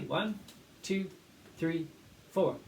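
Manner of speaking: steady count-in, one to four; teacher counting the class in. Shown as a demonstration.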